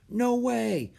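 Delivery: high-pitched mild amazement; breathed amazement. A narrator's reading. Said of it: "breathed Annie".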